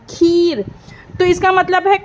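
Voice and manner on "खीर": "खीर" with a drawn-out ee; "खीर" said alone, vowel held long. Shown as a demonstration. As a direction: stretch the ee in "खीर" for learners. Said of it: "ई की sound बड़ी आ रही".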